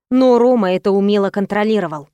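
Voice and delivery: plain reading voice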